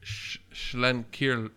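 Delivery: slow stuttered "Sch-, Schlenker-" sounded out; halting attempt. Real, not acted. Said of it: "I'm trying to pronounce the beer".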